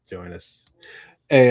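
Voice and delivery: ordinary talk, nothing marked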